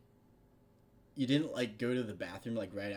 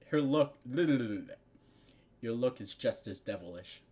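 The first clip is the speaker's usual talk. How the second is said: ordinary delivery, nothing marked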